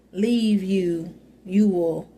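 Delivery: normal talking voice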